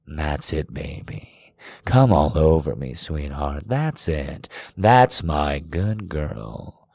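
low, soft encouragement in the ear; coaxing male lover. A narrator's reading. Man talking his lover through it, low and encouraging. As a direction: drop low and talk them through it. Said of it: "He speaks into my ear".

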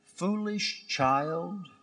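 soft, slow, tender mother; gentle. Acted. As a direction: ease it out lovingly to her son. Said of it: "she said gently".

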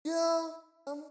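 soft, drawn-out unsure yeah; acting out a half-hearted yes; doubtful agreement. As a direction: hesitate and leave it unfinished. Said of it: "he said it with doubt".

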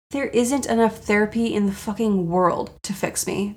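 ordinary talk, nothing marked

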